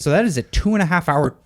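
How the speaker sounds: fast tumbling take of two and a half hour; words running ahead too quickly; rushed slip. Real, not acted. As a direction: rush the phrase then catch yourself. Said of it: "oops, sorry".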